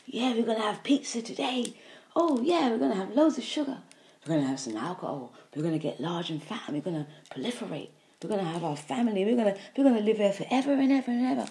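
fast, gleeful cheering parasite voice; party like greedy parasites at a feast. Acted. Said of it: "the parasites are happy".

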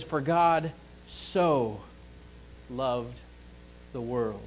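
"so" drawn out slowly and leaned on; preacher stresses "so" in John three sixteen, slow. Shown as a demonstration. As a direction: linger on the word "so". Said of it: "that emphasis on the word "so".

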